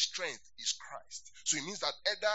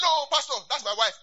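loud, high objection; husband protesting loudly; alarmed protest; 9 semitones higher, 13 dB louder than usual. Retold or imitated. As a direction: blurt it out in alarm. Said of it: "He said".

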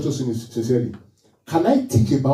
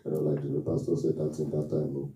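mock sulking churchgoer; 11 semitones lower, 10 dB quieter than usual. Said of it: "Why are you crying?".

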